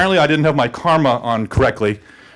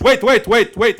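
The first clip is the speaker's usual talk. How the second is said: raised, insistent soldier's order; urgent halt; 5 semitones higher, 3 dB louder, slower than usual. Retold or imitated. Big bearded soldier halting the teller, raised urgent command. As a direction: stop him in his tracks. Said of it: "he goes".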